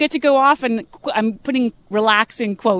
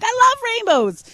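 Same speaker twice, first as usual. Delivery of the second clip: high, louder mock-gushing reply; gushing enthusiasm; 13 semitones higher, 4 dB louder, much faster than usual. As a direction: play up delight for a little kid. Acted gushing reply to a child.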